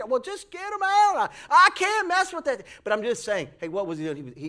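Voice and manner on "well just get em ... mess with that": higher, louder mock refusal; imitating Eli. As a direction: back away from the chipmunk job. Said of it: "quoting Eli on the phone".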